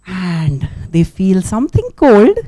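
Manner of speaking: ordinary delivery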